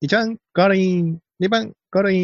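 imitating a measured race announcer; banei race caller impression. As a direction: announce each finisher like a trackside commentator. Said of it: "万栄競馬モノマネ".

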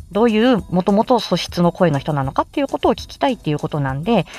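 normal talking voice

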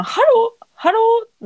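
sings out ハロー high and slow; ハロー drawn out high as a demonstration; high demo hello. Shown as a demonstration. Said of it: "ハローハローとかやったら".

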